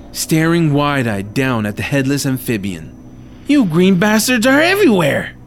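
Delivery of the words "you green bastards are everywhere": higher and louder outburst; outraged. Acted. Hero cursing the froglodytes, outraged. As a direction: spit it out in fury.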